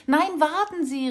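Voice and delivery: laut und höher gerufen; rufend. A narrator's reading. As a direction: Raffi ruft dem Gast eilig nach. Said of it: "rief Raffi". (eilig rufend)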